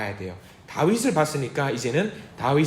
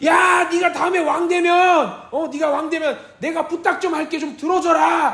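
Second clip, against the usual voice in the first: much higher, slow yelled request; king shouting a favour across the field; shouting from afar; 10 semitones higher than usual. Acted. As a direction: yell it to someone far off. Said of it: "이 장면은 소리 지르는 거야".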